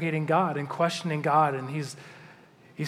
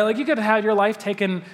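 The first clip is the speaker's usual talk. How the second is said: normal talking voice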